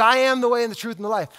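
rattled off quickly; fast recitation. Shown as a demonstration. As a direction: rush through the familiar verse. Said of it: "I want you to just to hear the claim".

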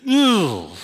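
dad's defeated groan from the bedroom; frustrated ugh. Retold or imitated. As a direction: groan like a sulking dad sent to his room. Sulking in the bedroom.

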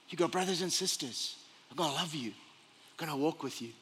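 gentle reassurance. Acted. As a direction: drop to a tender, quiet promise. Preacher voices reassurance of community.

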